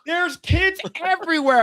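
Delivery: high-pitched exasperated protest; retold outraged protest; appalled scolding. Retelling his appalled reaction to the march.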